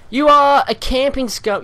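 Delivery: high, loud insult hurled at the screen; angry yell. Real, not acted. Gamer raging at the enemy who camped, yelling. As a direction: rage at the camper, full volume.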